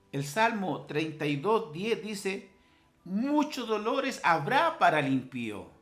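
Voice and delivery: high, emphatic pulpit reading; forceful preacher quoting the psalm; proclaiming scripture. Acted. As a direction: declaim the verse in measured phrases.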